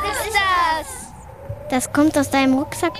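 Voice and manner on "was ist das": high, very slow, drawn-out question; startled. Acted. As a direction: stretch it out, spooked. Strange sound from the backpack.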